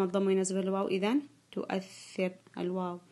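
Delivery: ordinary delivery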